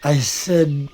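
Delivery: ordinary delivery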